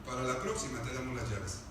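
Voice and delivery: low, soft, deadpan jailer; carcelero resignado. Acted. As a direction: offer it low and quiet, giving up. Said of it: "¿qué cree que le decían?". (carcelero resignado, voz grave y baja)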